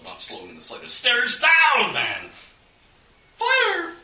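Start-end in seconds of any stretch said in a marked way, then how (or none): 1.0-2.3 loud, high bellow; bellowed demand. A narrator's reading. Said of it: "Onstag bellowed". 3.4-3.9 very high, loud shriek. A narrator's reading. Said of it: "a maid shrieked obligingly".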